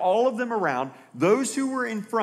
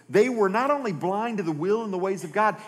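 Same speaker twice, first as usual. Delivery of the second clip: usual speaking voice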